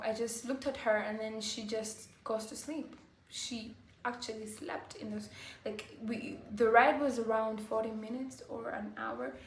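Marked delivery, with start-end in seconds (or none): none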